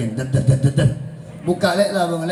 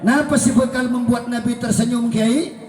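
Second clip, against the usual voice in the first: ordinary talk, nothing marked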